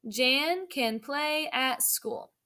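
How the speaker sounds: put-on slow, word by word for young readers; slow read-aloud. Shown as a demonstration. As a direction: read it out slowly so kids can follow. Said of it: "read it with me this time and follow along".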